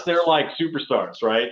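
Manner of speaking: usual speaking voice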